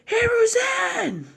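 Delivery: very high, long drawn-out wail of a name; slow high anguished call; grief cry. Acted. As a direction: stretch the name out in despair. Naruto sees Hiruzen dead.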